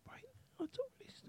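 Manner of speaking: high urgent whisper; breathy whispered excitement. Real, not acted. Quiet time whispering.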